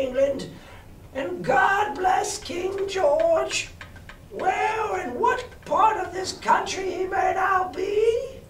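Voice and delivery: blind beggar's high, drawn-out wail; singsong beggar's plea, stretched and high; odd sing-song. A narrator's reading. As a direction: chant it slowly, high-pitched, calling into the air. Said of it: "raised his voice in an odd sing-song".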